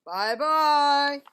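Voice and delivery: loud, long gruff bear farewell; Papa Bear calls farewell in a big gruff voice. A narrator's reading. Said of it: "a big, gruff voice".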